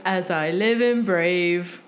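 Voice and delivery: slow mock Cockney drawl; bad Cockney impression. Friends joking about the trailer, slow mock Cockney. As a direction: say it like everyone mocking the trailer. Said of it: "everybody going".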